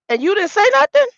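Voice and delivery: loud, high-pitched outraged question; retold indignant challenge, voice shooting up. Confronting her father for staying silent, indignant.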